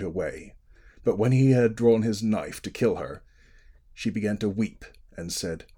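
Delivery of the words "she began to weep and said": plain reading voice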